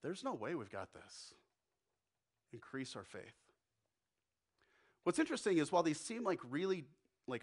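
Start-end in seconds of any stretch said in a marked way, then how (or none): 0.0-3.5 soft, low, defeated murmur; a man overwhelmed by the task, voice sinking; despairing Thomas. Acted. Thomas looks at his hands and admits defeat, soft and low. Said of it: "that voice where he's".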